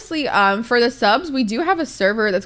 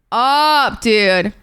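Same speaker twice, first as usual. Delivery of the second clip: loud burst of excitement; hyped up; 6 dB louder than usual. Real, not acted. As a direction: punch it out louder, all in. Excited about Family Feud.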